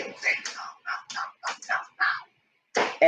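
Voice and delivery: hushed repeated no; a frantic whispered no no no; whispered urging. Retold or imitated. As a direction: whisper no over and over, urgently. Friend urging quietly off camera.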